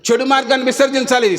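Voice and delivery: ordinary talk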